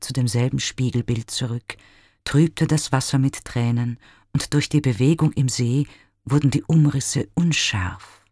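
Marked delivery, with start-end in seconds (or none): none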